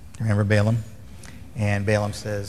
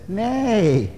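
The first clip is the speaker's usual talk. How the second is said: high, drawn-out archaic nay; mock archaic Nay; 11 semitones higher than usual. Balaam on the donkey answering it in a high mock archaic voice. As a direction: ham up the old-fashioned reply.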